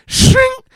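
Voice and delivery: high, loud blade-ring noise; mock sound effect of steel; imitated blade clash. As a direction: make a piercing metallic shing. Imitating riding crop stopping axe.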